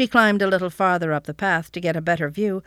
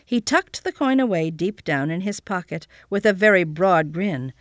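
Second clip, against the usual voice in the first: usual speaking voice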